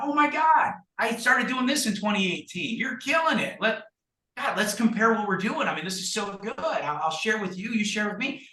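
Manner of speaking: very high, gushing excitement, acted out; mock amazement. Egging on a bragging agent, high mock amazement. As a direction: gush like an over-impressed fan, pitch way up.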